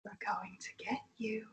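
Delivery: breathy whispered threat right in the ear; creepy whisper. Retold or imitated. Cloaked figure whispering a threat into the camper's ear. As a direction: hiss it close like a cloaked figure. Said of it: "it breathes out in my ear".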